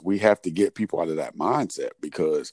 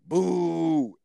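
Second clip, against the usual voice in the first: long, high-pitched playful boo; re-enacted booing; 10 semitones higher than usual. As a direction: lead the kids in a drawn-out jeer. Re-enacting boo taught to kids.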